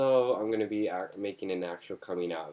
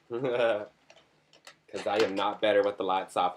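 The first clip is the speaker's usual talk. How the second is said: sassy flirt; 5 dB louder, much slower than usual. Pretend. Flirty joke, slowed and far.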